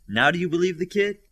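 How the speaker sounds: loud, high told-you-so question; triumphant. A narrator's reading. Olympian challenging Orbit about the kid.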